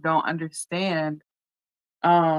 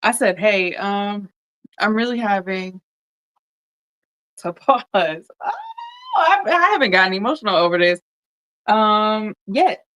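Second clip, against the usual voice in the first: higher, louder, fighting back tears; real tears breaking through mid-sentence; cracking up, half laughing, half crying; 4 semitones higher, 6 dB louder than usual. Real, not acted. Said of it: "I don't wanna get emotional".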